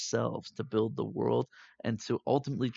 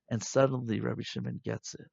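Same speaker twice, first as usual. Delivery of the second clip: usual speaking voice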